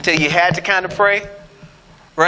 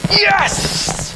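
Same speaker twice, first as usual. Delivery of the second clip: a sudden high cry of approval; excited yes; 11 semitones higher than usual. Real, not acted. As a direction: reward the right answer with delight. Congregation finally gives right answer.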